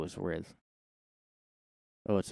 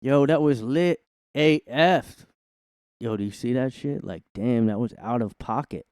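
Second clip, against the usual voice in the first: mock teen slang voice. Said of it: "just like".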